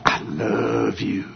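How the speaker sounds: hushed and drawn out, barely above a murmur; flat and quiet. Shown as a demonstration. Preacher demonstrating a different inflection, hushed and slow. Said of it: "depending on how you inflect the words".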